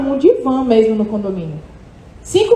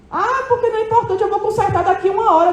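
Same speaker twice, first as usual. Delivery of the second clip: higher-pitched mock brush-off; dismissive excuse; 7 semitones higher, faster than usual. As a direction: wave it away in a higher mimicking voice. Voices a manager brushing off notice.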